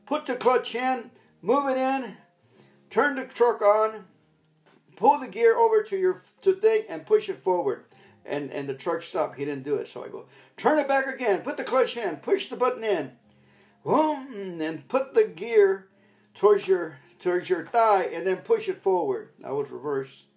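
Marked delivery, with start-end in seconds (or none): 0.1-7.8 higher, louder drill of truck commands; loud barked orders; shouted instructions. Retold or imitated. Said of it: "with my bar voice". 10.6-19.3 higher, louder drill of truck commands; loud barked orders; shouted instructions. Retold or imitated. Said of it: "with my bar voice".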